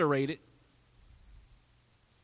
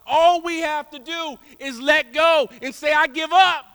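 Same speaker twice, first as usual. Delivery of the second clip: preaching climax; 8 semitones higher, 10 dB louder than usual. Acted. Climax of surrender.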